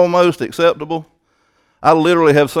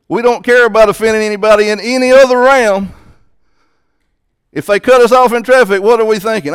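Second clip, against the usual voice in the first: higher and louder, driving the point home; fiery preaching; 6 semitones higher, 5 dB louder than usual. Acted. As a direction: preach it hard at the congregation. Preaching at full force.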